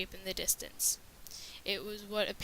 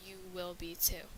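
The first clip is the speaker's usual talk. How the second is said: eerie whisper; 2 semitones lower, 7 dB quieter than usual. A narrator's reading. Eerie message read on wall.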